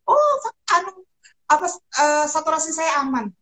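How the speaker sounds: imitated patient reassurance. Isolating patient reporting saturation is safe, imitated high. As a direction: pitch it up like someone reporting good news. Voicing patients unaware of their danger.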